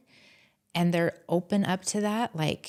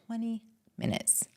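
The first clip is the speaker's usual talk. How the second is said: softer, dropped-down "Twenty minutes"; quiet aside; 4 semitones higher, 8 dB quieter than usual. Real, not acted. Answering her own question to the host, softly. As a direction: murmur "Twenty minutes" almost to yourself. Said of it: "Do you know how long it took me".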